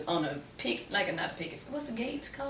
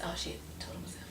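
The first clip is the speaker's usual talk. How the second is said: whispered aside; 16 dB quieter, faster than usual. Real, not acted. Aside to herself.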